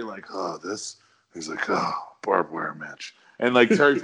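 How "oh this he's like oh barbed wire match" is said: low, subdued, dismissive mumble; not proud. Shown as a demonstration. Wrestler playing down his scar when asked, not proud. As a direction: shrug it off in a deep, slow voice. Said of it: "he didn't sound proud of it".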